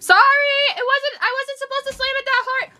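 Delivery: pleading. Real, not acted. Apologizing to angry mom.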